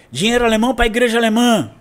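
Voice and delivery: retold shout. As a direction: call it out like a protester's slogan, without full volume. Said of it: "uma pessoa grita assim".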